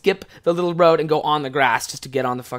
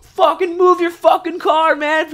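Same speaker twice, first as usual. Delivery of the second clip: high, loud mocking road-rage shout; exaggerated angry classmate shouting; imitated angry yell; 13 semitones higher, 6 dB louder, slower than usual. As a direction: yell at the stalled truck like a furious driver. Angry drivers yelling out windows.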